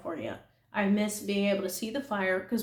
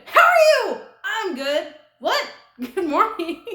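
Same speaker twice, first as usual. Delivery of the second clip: much higher and louder, flustered squeak; awkward squeaky greeting; 11 semitones higher, 11 dB louder, slower than usual. Retold or imitated. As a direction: blurt out the greeting in a panicked high squeak. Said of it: "I literally went".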